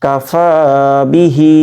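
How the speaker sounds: কাফা বিহি with long ফা and long হি; one-alif elongations. Shown as a demonstration. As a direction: keep কা and বি short, stretch ফা and হি. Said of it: "ফা এক আলিফ টান". (কাফা বিহি-তে ফা ও হি টেনে পড়া)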